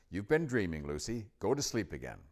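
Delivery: lightly voiced bossy big sister; annoying grown-up voice. A narrator's reading. Susan sends Lucy back to sleep, annoyingly grown-up. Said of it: "in her most annoying grown-up voice".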